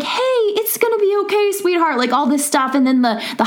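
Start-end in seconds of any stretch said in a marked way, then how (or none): 0.0-2.0 high, sugary reassurance; secretary comforting a nervous patient, high and sweet. Retold or imitated. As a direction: coo it like a kind receptionist. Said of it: "The secretary was like".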